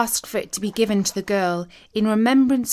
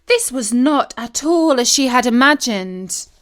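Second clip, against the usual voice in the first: ordinary delivery, nothing marked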